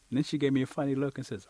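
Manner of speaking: ordinary delivery, nothing marked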